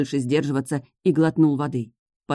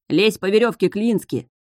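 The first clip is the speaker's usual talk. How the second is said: shouting from above; 5 semitones higher than usual. Acted. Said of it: "закричали мне сверху".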